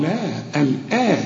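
usual speaking voice

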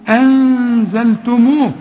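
أنزلتموه slow and loud with the ن hidden in a nasal hum. Shown as a demonstration. Teacher stressing the ikhfa for the student. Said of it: "اخفي وغن هنا".

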